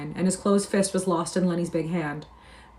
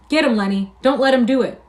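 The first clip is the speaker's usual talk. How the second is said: loud, high urging; yelling; 6 semitones higher, 7 dB louder than usual. A narrator's reading. George urging Lenny to fight back, yelling. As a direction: yell it like an order in a fight.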